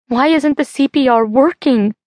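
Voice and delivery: desperate cry. Acted. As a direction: cry it out, pitch jumping up in fear. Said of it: "Becca cries".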